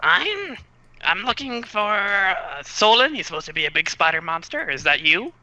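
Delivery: high little kobold voice. Acted. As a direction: squeak it out, a bit hurried. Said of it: "Why do I always have to do two voices at once?".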